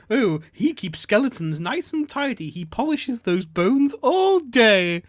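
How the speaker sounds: high, loud, drawn-out gushing parody; mocking advert woman. Man improvising what the advert woman would say, mocking. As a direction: build to a long, swooping finish. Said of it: "what would they say there".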